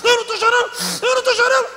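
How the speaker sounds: very high, loud screech, repeated on one pitch; hammed-up screeching insistence; frantic mock denial. Overwrought woman insisting she isn't crying, screeched. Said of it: "a mulher doidona, escandalosa".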